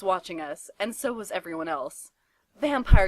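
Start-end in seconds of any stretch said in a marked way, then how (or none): 2.6-3.1 much louder, higher cry of his name; outraged yell while slapping him; furious shout. A narrator's reading. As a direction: yell it as you slap him.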